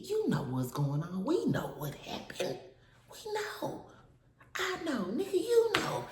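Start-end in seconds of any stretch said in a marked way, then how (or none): 3.1-5.0 breathy high taunt; mocking whisper. Taunting the ex who stole her chain, high mocking whisper. 5.1-6.0 squeaky high teasing jab; high mocking taunt. Calling out the ex who stole her chain, high teasing taunt.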